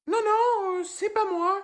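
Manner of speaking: squeaky high-pitched panic; panicked denial. Acted. Son denies being Tom in front of his teacher, panicked high squeak. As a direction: deny it in a strangled high squeak.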